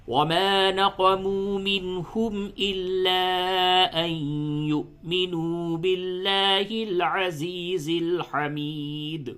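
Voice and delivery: slow, high, drawn-out tajweed chant; Quran recitation. Shown as a demonstration. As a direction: recite it slowly with full tajweed.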